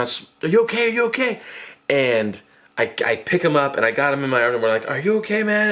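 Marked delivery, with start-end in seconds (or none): 0.0-1.4 high worried calling out. Retold or imitated. Checking on injured friend. 4.9-5.7 higher, anxious asking; worried check-in. Retold or imitated. Checking on injured friend.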